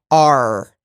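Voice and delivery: loud "R" with the American R sounded; pronounced R. Shown as a demonstration. Actor contrasting three accents, "R" with R pronounced. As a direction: finish "R" on a hard R. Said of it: "See, the R".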